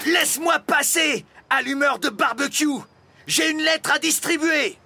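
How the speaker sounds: high, loud postman's challenge; shouted demand. A narrator's reading. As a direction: shout at him to get out of the way. Postman confronts the dragon blocking him. (sommation criée, voix très haute)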